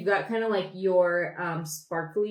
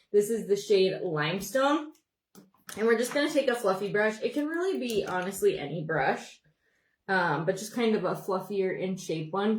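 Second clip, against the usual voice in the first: ordinary talk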